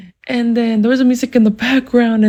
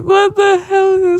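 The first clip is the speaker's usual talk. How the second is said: high, acted-out exclamation; retold shock, pitched way up; shocked outburst; 8 semitones higher, 3 dB louder, slower than usual. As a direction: cry it out in disbelief. Acted shock inside the dream.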